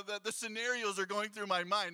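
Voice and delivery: usual speaking voice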